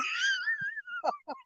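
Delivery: high, soft wheezy laugh; helpless thin, high laughter. Real, not acted. Angler cracking up after blaming fans, high wheezy laugh.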